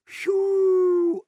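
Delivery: high, loud whistle; long high-pitched whistle. A narrator's reading. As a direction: whistle in astonishment. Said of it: "аж свиснув доктор".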